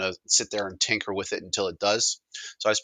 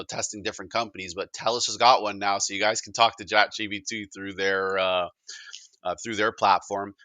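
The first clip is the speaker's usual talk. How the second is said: normal talking voice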